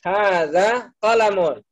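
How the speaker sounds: slow, clear "Hadza qalamun" with stress on "qa"; model answer. Shown as a demonstration. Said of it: "bisa diulangi". (ustaz mencontohkan jawaban dengan pelan)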